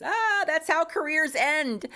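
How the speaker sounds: hammed-up dismay, high and slow; mock dismay. Mocking the fear that boundaries end careers, mock dismay. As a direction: draw it out in high mock alarm.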